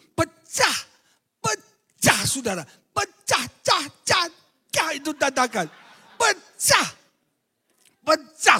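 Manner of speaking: high, loud shouted pecah; excited exclamation. Acted. Preacher exclaiming the desk shattered, high and loud. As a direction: burst out with amazement.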